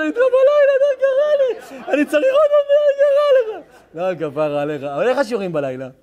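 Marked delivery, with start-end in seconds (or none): 0.0-3.6 much higher, squeaky retelling of his own words; mock high squeal. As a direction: squeak it out, embarrassed and teasing. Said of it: "אמרתי לו". (ציוץ גבוה ומתגרה)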